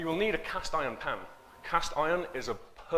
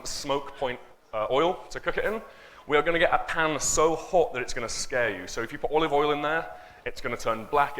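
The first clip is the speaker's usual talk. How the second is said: normal talking voice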